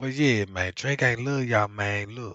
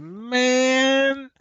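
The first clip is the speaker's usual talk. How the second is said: startled; 10 semitones higher, 4 dB louder than usual. Retold or imitated. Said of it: "I said".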